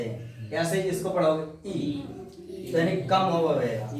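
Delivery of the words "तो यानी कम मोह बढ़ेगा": usual speaking voice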